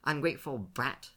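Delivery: scolding while holding back a smile; mock curse. A narrator's reading. Gerda fondly scolds her grandson, suppressing a smile. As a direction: curse him but almost laugh. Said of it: "she cursed, holding back a smile".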